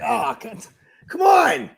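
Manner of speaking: mock whine rising to a loud plea; half-joking exasperated outburst; exasperated protest. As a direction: act out a frustrated groan of disbelief. Retold reaction to bad call.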